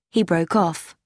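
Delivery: plain reading voice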